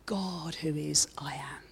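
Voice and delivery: whispered and slow; hushed slow whisper of I am; breathed whisper. Real, not acted. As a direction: breathe the words out softly. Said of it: "You almost want to just breathe in".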